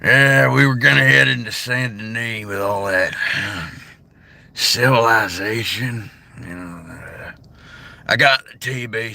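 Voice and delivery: a tired cowboy telling his sickness; Arthur Morgan impression. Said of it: "I can do Arthur Morgan".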